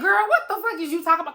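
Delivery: loud, high-pitched disbelieving retort; incredulous cousin. Retold or imitated. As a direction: imitate a cousin who can't believe this. Cousin laughing in disbelief at her.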